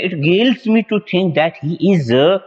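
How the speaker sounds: teacher reading out an example sentence slowly. Shown as a demonstration. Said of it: "तो कह सकते हैं".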